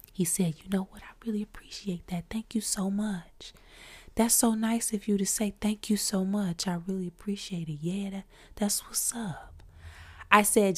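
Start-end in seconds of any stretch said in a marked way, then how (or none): 0.6-9.6 soft, whispery imitation of a smooth singer; R and B dude voice. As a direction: imitate a hushed R and B star giving thanks. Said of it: "He talk like an R and B dude".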